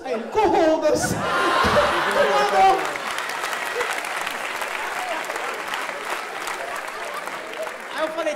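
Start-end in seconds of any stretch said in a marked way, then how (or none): 0.3-1.1 much louder, high "Corroda-se"; mock Portuguese curse. Portuguese clerk echoing the curse, loud and high. 1.2-7.9 high loud breathless laughter; long laughing fit. Real, not acted. Laughing at the mangled curse, long loud breathless laughter.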